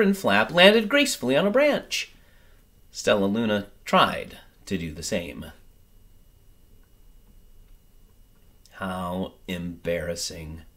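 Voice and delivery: gentle storybook reading, lively and clear; story narration. A narrator's reading. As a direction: read it like a bedtime picture book. Reads picture book aloud.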